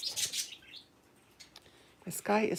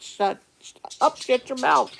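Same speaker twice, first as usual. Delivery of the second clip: retold shut-up, rising higher and louder; mock telling-off; 7 semitones higher, 4 dB louder than usual. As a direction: act out scolding a husband, building in pitch. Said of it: "I am continuously telling my husband to shut up, and I mean it".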